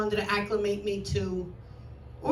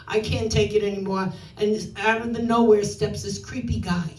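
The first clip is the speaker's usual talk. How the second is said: ordinary delivery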